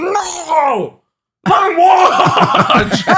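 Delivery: very high, drawn-out hammed-up scream; Grimace, really mad. Grimace stops the mother, long hammed-up furious scream. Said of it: "Grimace gets really mad".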